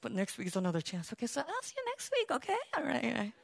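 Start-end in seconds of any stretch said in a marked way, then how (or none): 1.1-3.3 soft patient reassurance. Retold or imitated. Gentle retry with newcomer.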